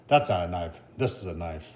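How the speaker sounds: a hint of the Aussie knife hero, dropped low; bad Crocodile Dundee. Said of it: "that was a bad imitation".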